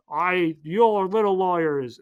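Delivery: mock villain taunt. Fisk mocking the lawyers.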